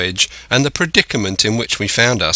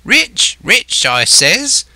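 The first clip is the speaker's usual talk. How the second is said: high, rising, stretched-out insistence; hotly insistent; 10 semitones higher, 4 dB louder, much slower than usual. A narrator's reading. Castaway repeating his claim to doubting Jim, hotly. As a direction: hammer it home hotly, pitch leaping up. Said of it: "he repeated the statement hotly".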